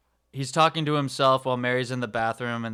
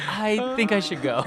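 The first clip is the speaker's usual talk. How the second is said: Mary's reply; 8 semitones higher than usual. Retold or imitated. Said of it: "And she's like".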